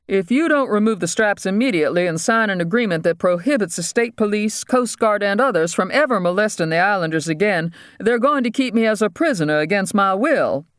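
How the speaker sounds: high-pitched, urgent demands; demanding captive dentist. Acted. Voices the dentist.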